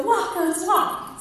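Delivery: put-on high, loud child echoing a command; imitating little boy. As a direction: mimic a small child shouting orders. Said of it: "then I hear Elias go".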